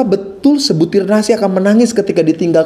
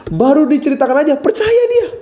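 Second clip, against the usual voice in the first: amused; 7 semitones higher than usual. Real, not acted. Amused at child's belief.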